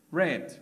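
"read" with a short e, like "red"; "read" rhyming with "bed"; past participle "read". Shown as a demonstration. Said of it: "lo que varía es la pronunciación".

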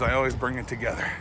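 low soft quick aside; quiet muttered repeat. Shown as a demonstration.

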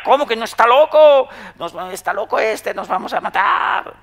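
mock a frantic passenger screaming that the driver's mad; hysterical panic. Neurotic person panics that they'll crash, mock hysterical shriek. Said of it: "todavía se pone más histérica".

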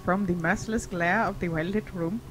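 calm storyteller reading aloud; story narration. Acted. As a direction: read the story text evenly. Reads game story text aloud.

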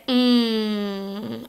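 "Mm." as a held nasal NG, tongue at the back; NG sound. Shown as a demonstration. Teacher showing the nasal NG sound to the class. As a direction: hum "Mm." through the nose, back of the tongue up. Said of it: "we say".

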